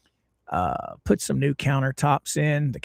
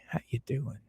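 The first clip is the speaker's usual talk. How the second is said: whispered greeting; soft whispered hello to a viewer; 2 semitones lower, 12 dB quieter, faster than usual. Real, not acted.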